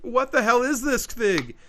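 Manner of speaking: mock horror. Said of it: "are kinda like".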